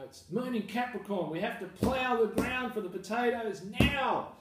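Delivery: high, loud, insistent rule-follower; mock urgent strict planner; pedantic past self. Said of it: "So, you know".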